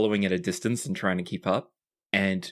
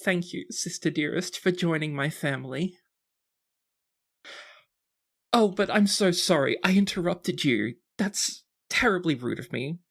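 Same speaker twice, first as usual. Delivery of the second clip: put-on high, sweet, sniffly girl; a tipsy teen gushing then apologising; teary grateful sister; 5 semitones higher than usual. GM voicing Antonia hugging Juliana, high and sweet. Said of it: "you hear a sniffle".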